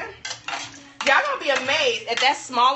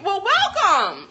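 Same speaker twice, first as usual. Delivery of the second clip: mouth full; 10 semitones higher, 6 dB louder, much slower than usual. Real, not acted. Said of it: "I'm sorry I'm eating".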